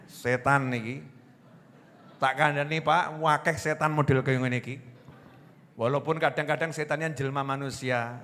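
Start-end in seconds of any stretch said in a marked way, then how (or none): none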